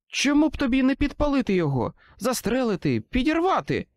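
high, fast and pressing; urgent suggestion. A narrator's reading. Susan calls up from the lab, high urgent rush. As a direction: rattle off options in a hurry.

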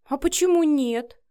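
coy challenge; feigned innocence. A narrator's reading. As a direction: play innocent to provoke. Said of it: "захлопала Вика глазами".

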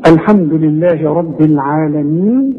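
recited, العالمين ending on a briefly held "iin"; short final madd. Shown as a demonstration. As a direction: hold the last vowel of العالمين two beats. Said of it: "ممكن تقف عليها حركتين وأربعة وستة". (تلاوة بمد العالمين حركتين)

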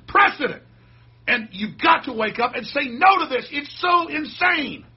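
loud, high ranting yell; shouting rant at the viewers, rising up. Real, not acted. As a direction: bellow it at the audience. Ranting call to wake up.

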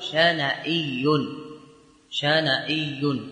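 drawn out twice; slow demonstration. Shown as a demonstration. Teacher repeating the answer for students, slowly. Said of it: "فنقول".

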